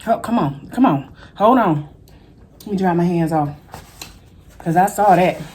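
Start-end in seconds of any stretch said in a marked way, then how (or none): none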